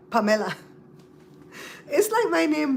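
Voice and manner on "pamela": "Pamela" as pa-me-la with a clear e; Belizean Pamela. Shown as a demonstration. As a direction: stress the e in "Pamela". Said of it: "There's an E in there".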